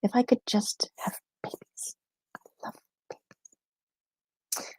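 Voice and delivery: whispered, slow, dreamy sigh over babies; confessional whisper. Real, not acted. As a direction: breathe it out like a guilty secret. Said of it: "unhealthy love for babies".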